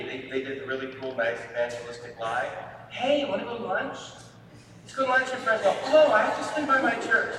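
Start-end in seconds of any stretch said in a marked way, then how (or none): 2.9-7.4 much higher and louder, sing-song bait; mock cheery invite. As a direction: play the sweetly sneaky churchgoer with a lunch offer. Mock friend's lunch invite lie.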